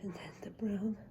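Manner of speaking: breathy hushed whisper. Shown as a demonstration. Teacher whispering the cap order to children. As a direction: breathe the words out softly. Repeated line, whispered take.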